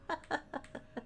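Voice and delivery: very soft chuckle; hushed giggle at own pun; laugh. Real, not acted.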